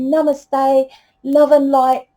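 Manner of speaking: high, held-note love-and-light voice; mock spiritual monotone. Reader mocking love-and-light healers in their high monotone. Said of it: "used to that energetic frequency of that monotone".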